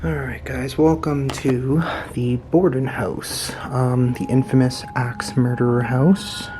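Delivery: slow hushed whisper; nervous night vlog whisper. Real, not acted. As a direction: whisper it slowly, as if afraid to wake the house. Whispered intro in haunted house.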